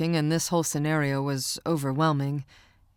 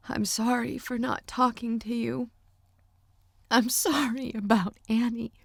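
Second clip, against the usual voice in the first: crying; 6 semitones higher than usual. A narrator's reading. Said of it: "I cried".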